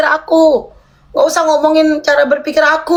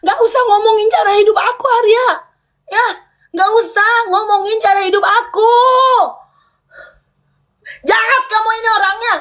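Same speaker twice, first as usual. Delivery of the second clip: higher, heated, cutting him off; angry protest; 6 semitones higher than usual. Real, not acted. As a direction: snap back at him, fed up with being talked about. Repeats refusal, voice rising angrily.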